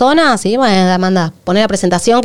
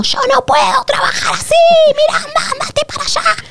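mock yell, much higher and strained; imitating a yell. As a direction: play back her frazzled outburst, pitched way up. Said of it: "fue la primera vez que te escuché gritar". (imitando un grito agudo y tenso)

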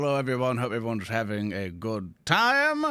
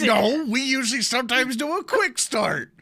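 excited laughing; 7 dB louder than usual. Real, not acted.

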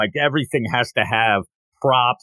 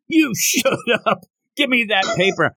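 high-pitched retold outburst, no real volume; hammed-up high yell, half-laughing; mock yell; 9 semitones higher than usual. Damien snapping back at the girl, high mock yell. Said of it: "as that's yelled".

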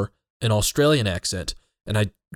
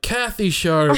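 Siri; 6 semitones higher, slower than usual. Retold or imitated. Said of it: "And it's like".

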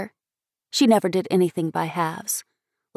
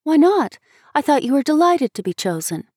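higher, puzzled and amazed; astonished; 7 semitones higher, 3 dB louder than usual. A narrator's reading. Schoolmate questioning the little girl, astonished. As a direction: question her in open surprise. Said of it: "in astonishment".

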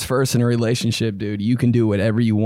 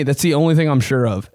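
ordinary talk

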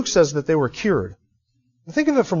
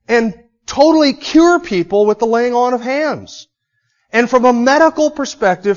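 raised, louder impassioned preaching; preaching at full force; 7 semitones higher, 6 dB louder than usual. Acted. Preacher telling how Paul outdid Doctor Luke, in full fervour. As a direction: drive the story home to the congregation, higher and louder.

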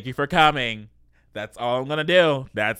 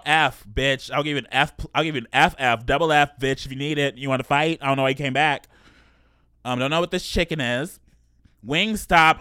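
ordinary talk

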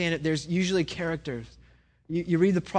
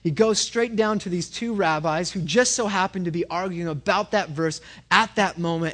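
normal talking voice

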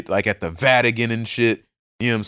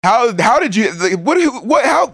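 incredulous; 9 semitones higher, 4 dB louder than usual. Real, not acted. Reacting to a plot twist.

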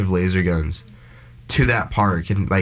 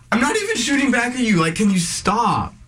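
pleading whine; 12 semitones higher than usual. Retold or imitated. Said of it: "and I'm like".

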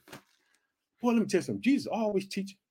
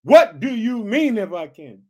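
Jesus rebuking; 2 semitones higher, 7 dB louder, slower than usual. Retold or imitated. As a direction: throw the doubt right back at him. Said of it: "Jesus backed it up".

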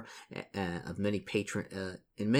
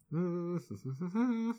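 high, soft humming giggle; closed-mouth chuckle; 10 semitones higher, 5 dB quieter than usual. Real, not acted. Reader amused between game pages, soft high chuckle. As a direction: giggle through closed lips, rising in pitch.

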